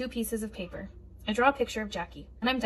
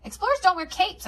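bossy; 8 semitones higher than usual. A narrator's reading. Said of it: "I tell her".

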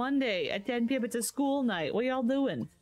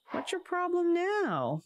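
high, slow exasperated demand; 10 semitones higher than usual. Real, not acted. Asking Aaron his problem after he refused, high drawn-out exasperation. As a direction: stretch it out high, fed up.

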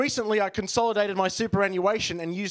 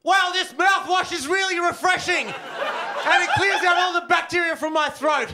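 much higher, shouted, laughing through a gargle; Chewbacca gargling; 11 semitones higher, 5 dB louder than usual. Acted. Comic impression for the crowd, shouted Chewbacca gargle. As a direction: gargle it out as a roaring wookiee. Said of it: "Chewbacca gargling".

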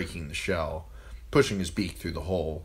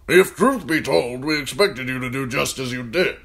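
put-on raised, loud commanding old man; gruff old lord commander; 8 semitones higher, 8 dB louder than usual. A narrator's reading. Old commander ordering John to serve food, loud and gruff. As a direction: bark orders at the boy like an old soldier. Mormont voiced in story.

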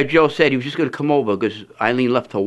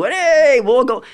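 high, louder cheery hillbilly holler; hillbilly call-out; 11 semitones higher, 3 dB louder, much slower than usual. Retold or imitated. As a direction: call out the car window in a high twang. Hillbilly family waving from car.

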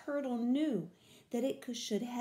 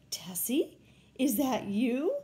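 rising, louder call of recognition; surprised cry; 6 dB louder than usual. A narrator's reading. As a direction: call out to a long-lost sister. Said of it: "cried Tammy".

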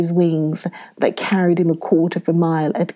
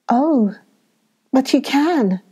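higher, eager plea; eager cry; 6 semitones higher than usual. A narrator's reading. Girl pleading with the sleepy saint, eager cry. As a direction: burst in hopefully. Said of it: "cried Effie".

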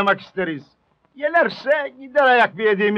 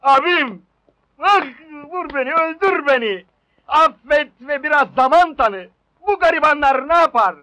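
pleading cry; 7 semitones higher than usual. Acted. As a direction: beg him, hammed-up. Pleading dramatically with landlord.

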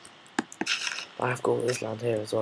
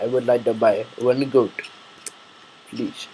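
louder mock Middle Eastern accent; 7 dB louder than usual. Customer asking to buy a goat, Middle Eastern accent. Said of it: "doing that in a weird Middle Eastern, uh, accent".

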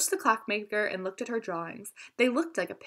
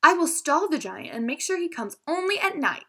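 brave; 7 semitones higher, 3 dB louder than usual. A narrator's reading. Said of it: "Madison said bravely".

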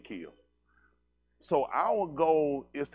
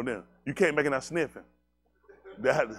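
usual speaking voice

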